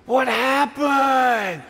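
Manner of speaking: put-on high, slow shocked child voice; a kid gasping in shock, drawn out. Kids freak out seeing him.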